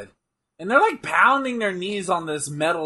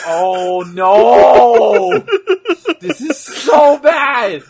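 loud, slow, horrified and laughing; 5 semitones higher, 10 dB louder, much slower than usual. Real, not acted. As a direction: wail it out, half laughing.

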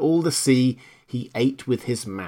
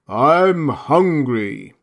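very slow, loud, booming; a huge whale groaning hunger; hungry whale; 3 semitones higher, 5 dB louder, much slower than usual. Acted. Whale speaks.

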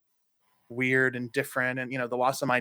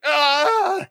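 mock exasperated groan; 13 semitones higher, 7 dB louder than usual. Listeners' retold exasperation.

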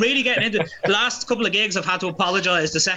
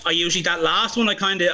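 ordinary talk

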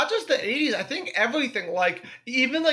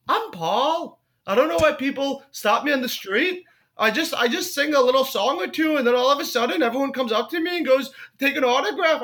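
higher-pitched attempt at a British singer; put-on humble star, pitched up; 4 semitones higher than usual. Famous singer telling an interviewer he is nobody, high mock-British voice. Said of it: "this is my impression".

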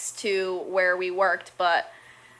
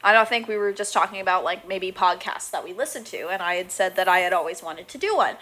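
normal talking voice

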